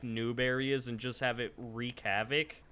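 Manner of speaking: ordinary talk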